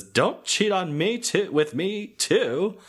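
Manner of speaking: high, drawn-out mock protest; hammed-up high-pitched indignation; acted indignant outburst. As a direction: stretch it out high, like a melodramatic complaint. Acts out her slap and outburst.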